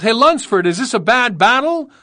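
loud, high yelled question. A narrator's reading. Rookie in a shell hole asking the veteran, yelling. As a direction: yell over the shelling to your leader. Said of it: "One of the guys yelled".

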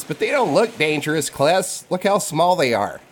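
put-on high, eager little kid voice; child voice. GM voicing a half-orc kid to the players, high childlike. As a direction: chirp it out like a curious small boy. GM as Bug pleading.

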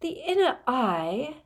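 slow, high, grand pronouncement; dignified. A narrator's reading. Trelawney defending her inner eye, with dignity. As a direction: draw it out with lofty dignity. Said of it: "said Professor Trelawney with dignity".

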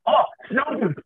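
high-pitched mock sighting call; mock excited call. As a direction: imitate buddies calling out a bird from the boat. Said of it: "we're just like".

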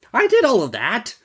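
high-pitched meek merchant voice; merchant's answer. Acted. Merchant confesses to the genie, high character voice. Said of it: "answered the merchant".

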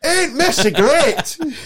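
very high, very slow, laughing mock voice; mocking a mate's voice. Teasing a mate's voice note, high and laughing. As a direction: stretch it out high while cracking up. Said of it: "and then your".

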